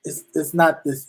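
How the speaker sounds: ordinary talk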